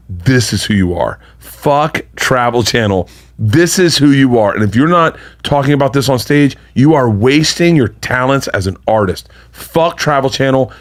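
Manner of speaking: slow, deliberate pep talk; slow and emphatic. Retold or imitated. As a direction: lay it down slowly, every word weighted. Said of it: "he goes".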